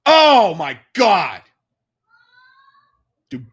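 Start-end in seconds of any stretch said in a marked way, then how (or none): 0.1-1.4 slow, higher, louder drawn-out gasp of awe; stunned. Real, not acted. Watching the fight, he draws out slow, loud awe. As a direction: let it stretch out in amazement.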